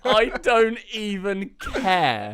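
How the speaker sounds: slow, high quote through laughter; laughing. Retold or imitated. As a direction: read it while cracking up. Quoting tweet while laughing.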